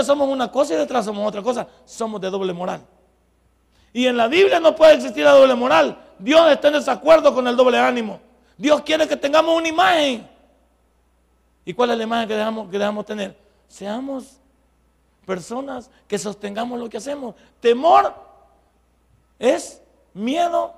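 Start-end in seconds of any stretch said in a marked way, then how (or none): 3.9-10.3 loud, fervent preaching, louder and higher; preaching shout. Real, not acted. Pastor preaching at full force. 17.6-20.7 a sudden loud, higher question word; loud challenge to the church; challenging call. Real, not acted. Pastor challenging the congregation.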